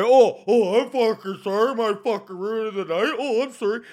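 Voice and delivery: loud, high-pitched mock-offended apology; exaggerated indignant whine of "oh, I'm sorry". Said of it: "get offended".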